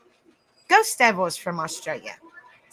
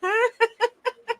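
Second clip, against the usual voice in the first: high burst of laughter; 12 semitones higher than usual. Real, not acted. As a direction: giggle high up. Laughing at chat.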